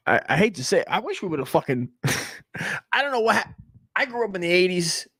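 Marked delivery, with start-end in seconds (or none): none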